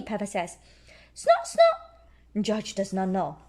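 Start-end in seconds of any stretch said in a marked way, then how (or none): 1.1-2.4 very high squeaky snorts; pig snorts. A narrator's reading. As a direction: snort twice like a piglet. George snorts.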